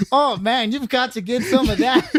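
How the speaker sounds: hammed-up imitation of an excited older co-worker; Jerry.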